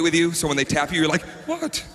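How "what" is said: sudden high startled yelp; startled what. Retold or imitated. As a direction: jump out of a daze. Startled when tapped on shoulder.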